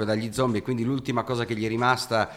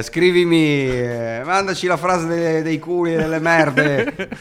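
higher, louder mock fan plea; imitating a fan; 7 semitones higher, 7 dB louder, slower than usual. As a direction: beg for the catchphrase like an eager fan. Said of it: "ogni tanto mi chiedono".

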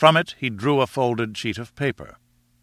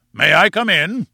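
loud, raised booming call; 8 semitones higher, 6 dB louder than usual. Acted. Newcomer announces himself from the veranda, booming. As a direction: boom it out, louder and higher. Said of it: "boomed a voice from the veranda".

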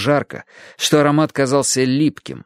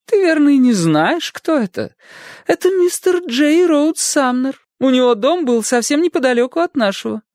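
hammy stage actor. A narrator's reading. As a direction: present him like a star. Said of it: "театрально воскликнула Аделаида". (театральная пожилая дама)

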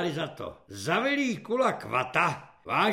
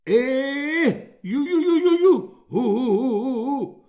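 high, loud "ju-ju-ju, hu-hu" babble; dim-witted hooting; 8 semitones higher, 10 dB louder than usual. A narrator's reading. Simple-minded recruit ordered to stand, hooting. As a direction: hoot repeated syllables in a high voice. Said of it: "udělal slabomyslný vojín".

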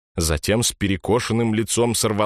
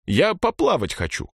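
put-on insistent boy; 12 semitones higher than usual. A narrator's reading.

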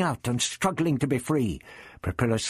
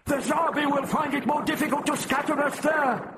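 high, strained battle call; crying out. A narrator's reading. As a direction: urge the others on at high pitch. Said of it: "Propilis cried".

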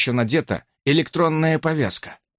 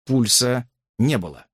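ordinary delivery

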